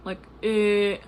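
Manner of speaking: eh said through pursed duck lips; duck lips mimicking. Shown as a demonstration. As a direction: push the lips into a pout on eh. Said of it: "like, the duck lips".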